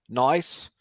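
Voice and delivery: a quick, lightly faked Australian "Nice" with the vowel bent to "noice"; bad Australian accent. Greeting an Australian viewer, mock Australian accent. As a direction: toss off a jokey Australian "Nice". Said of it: "I know that was a horrible accent".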